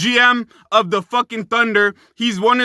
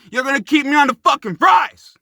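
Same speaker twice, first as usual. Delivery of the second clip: worked-up shout; 5 semitones higher than usual. Real, not acted. Vlogger ranting to fans about the Celtics, worked-up shout. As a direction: yell it, really worked up over the fries. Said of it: "I'm getting worked up".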